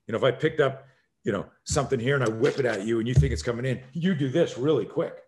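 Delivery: ordinary talk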